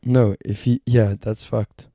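ordinary delivery

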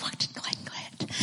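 breathless, hushed curiosity; hushed whisper. Retold or imitated. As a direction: whisper the question under the breath. Family waiting on Christmas morning.

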